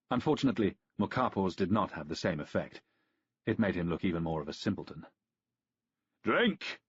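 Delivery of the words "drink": bellowed. A narrator's reading. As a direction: bellow it across the room. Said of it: "Aurelian bellowed".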